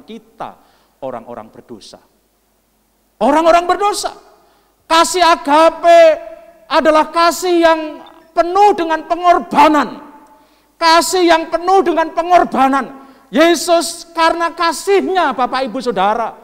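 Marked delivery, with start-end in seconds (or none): 3.2-4.1 much higher and louder; full-force preaching shout. Real, not acted. Preacher repeating who Jesus loves, shouted with full force. 4.9-16.3 high, very loud, sustained proclamation; full-force preaching. Real, not acted. Preacher telling congregation of sacrificial love, shouted at full force.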